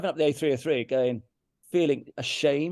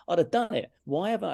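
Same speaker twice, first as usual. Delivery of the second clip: higher, exasperated at himself; getting angry; 5 semitones higher, 3 dB louder than usual. Real, not acted. Musician admitting he'd have played for money, getting angry. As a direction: let real anger at yourself rise. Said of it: "I get quite angry now".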